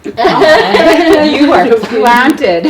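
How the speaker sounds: loud, slow words through real laughter; bursting with laughter; laughing. Real, not acted. Host laughing with delight.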